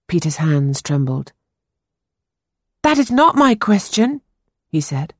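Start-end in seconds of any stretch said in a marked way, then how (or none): 2.8-4.2 put-on higher, earnest young boy voice. A narrator's reading. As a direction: play the anxious ten-year-old boy. Boy Peter voiced in story.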